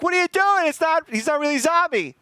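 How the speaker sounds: high, loud frantic shout; mock panicked yell. Friends yelling at the shooter, high panicked mock yell. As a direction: scream it at your friend in horror. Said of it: "And they're like".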